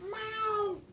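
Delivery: squeaky high meows; high meow imitation. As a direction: call the cat with a meow. Calling the cat.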